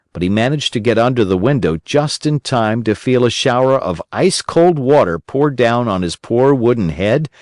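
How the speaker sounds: plain reading voice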